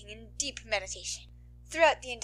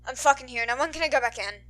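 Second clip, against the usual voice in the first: louder, irritated snarl at the teacher; angry snarl; 7 dB louder than usual. A narrator's reading. Bakugo snarls at his teacher outside, angry. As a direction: snarl it, fed up. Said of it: "he snarls".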